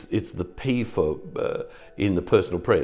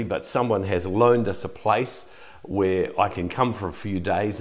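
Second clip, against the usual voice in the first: usual speaking voice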